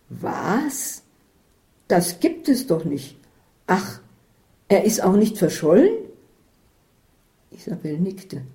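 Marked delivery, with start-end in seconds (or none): 0.1-5.9 can't believe what she hears; rising astonishment, getting louder; astonished. Acted. Astonished character reply.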